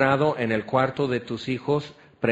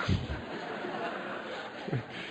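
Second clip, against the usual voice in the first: breathy laugh; 3 semitones lower, 12 dB quieter than usual. Real, not acted. Laughs at his own joke.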